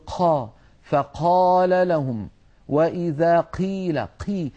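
slow "قيل" with a puff of breath on the qaf; breathy whispered qaf. Shown as a demonstration. Teacher mimicking colloquial habit, aspirated qaf. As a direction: air gushing out after the ق in "قال" and "قيل". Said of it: "همس القاف".

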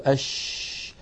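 "أش" with the sh sound stretched out; long continuous hush on "أش"; prolonged sheen. Shown as a demonstration. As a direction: let the sh in "أش" run on and on. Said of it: "نجد بأن الصوت يمتد".

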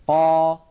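loud, held "قا" with no breath; voiced clean qaf. Shown as a demonstration. Teacher correcting the fault, strong airless qaf. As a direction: strike "قا" hard, breath held back. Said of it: "لأن القاف حرف مجهور".

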